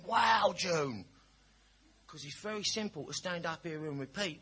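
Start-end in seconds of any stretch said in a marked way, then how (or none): 0.0-1.0 loud, high, exaggerated wow; hammed-up awe at a copycat; mock amazement. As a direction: sarcastically overdo being impressed. His mock amazed reaction.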